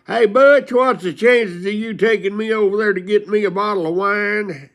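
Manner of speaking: put-on high, loud calling old drunk; imitating old Tom. Old Tom calling to Butch for a wine run, high and loud. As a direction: call out high like the old wino neighbor. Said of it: "and he goes".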